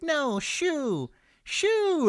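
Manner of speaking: very high mock shoo; shooing the bear. Imitating Grizzly Man shooing the bear, very high. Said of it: "but he's like".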